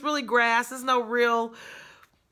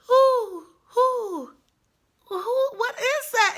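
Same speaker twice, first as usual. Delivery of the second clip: owl hoot; 13 semitones higher, 4 dB louder, slower than usual. Retold or imitated. Owl hoot turned into a question.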